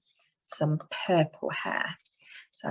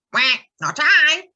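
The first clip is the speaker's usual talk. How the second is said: duck voice; 8 semitones higher, 7 dB louder, slower than usual. Acted. Duck answers in story.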